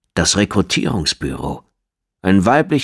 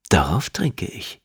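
slow husky whisper; 4 dB quieter, slower than usual. Acted. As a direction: breathe the toast slowly. Intimate toast.

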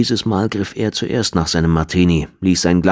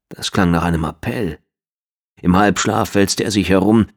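plain reading voice

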